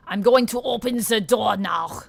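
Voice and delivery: mock German accent, loud and stiff; German accent attempt. Shown as a demonstration. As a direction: say it like a hammed-up German. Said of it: "Like that?".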